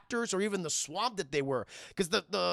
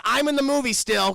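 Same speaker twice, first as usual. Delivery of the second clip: loud, raised plea for attention; mock plaintive interjection; 5 semitones higher, 9 dB louder than usual. Forgotten actor asking for something to do, loud mock plea. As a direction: pipe up loudly as a neglected cast member.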